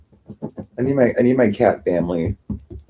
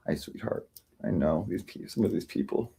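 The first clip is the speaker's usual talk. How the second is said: soft whisper to cat; 14 dB quieter than usual. Real, not acted. Greeting his cat softly.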